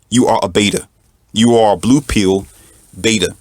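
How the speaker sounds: usual speaking voice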